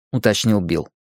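plain reading voice

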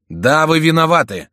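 loud, high-pitched retort; accusing cry. A narrator's reading. Антраге blames the opponents for the death, accusing cry. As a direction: hurl the accusation back. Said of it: "вскричал Антраге".

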